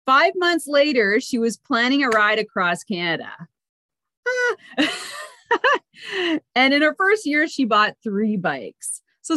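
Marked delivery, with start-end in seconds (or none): 4.2-5.3 short high chuckle; brief giggle between sentences; laugh. Real, not acted. Host reading a guest's bio, short high laugh.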